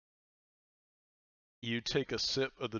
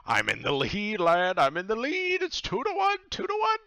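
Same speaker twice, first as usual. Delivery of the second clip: climbing much higher and louder, squealing with glee; gloating pirate captain; 12 semitones higher, 11 dB louder than usual. Acted. Pirate captain boasts to the player about leading two to one, high loud crowing. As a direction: crow the score in a shrill rising cheer. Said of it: "this is him saying it out".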